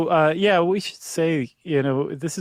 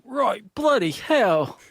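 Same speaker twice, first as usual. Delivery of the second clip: slow, hammed-up British accent; 7 semitones higher, much slower than usual. Imitating the Texan woman who woke up British, mock British accent. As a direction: drawl it out in a cartoonish British accent. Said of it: "how good my British accent is".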